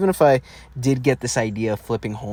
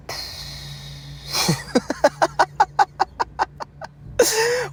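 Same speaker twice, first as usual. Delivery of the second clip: high-pitched giggle. Real, not acted. Struggles to think of positives.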